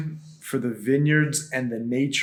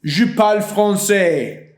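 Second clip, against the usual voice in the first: imitating a bratty kid; 3 semitones higher, 5 dB louder, slower than usual. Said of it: "Some kids would be like".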